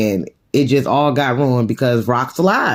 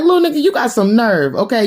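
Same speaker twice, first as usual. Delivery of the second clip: high-pitched indignant rebuke; retold outrage, pitched up; outraged mother; 7 semitones higher than usual. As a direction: act out an affronted parent. Mother outraged at son's retort.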